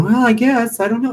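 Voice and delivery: high-pitched imitation of a passive shrugger; mock helpless hesitation. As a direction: mimic someone meekly giving up, pitched well up. Mimics passive people sitting there.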